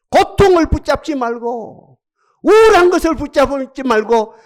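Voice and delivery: fervent prayer. Acted. Pastor praying aloud for the congregation, fervent and loud. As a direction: cry out to God with rising force.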